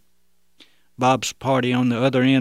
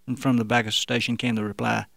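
usual speaking voice

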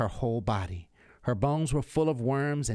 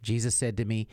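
plain reading voice

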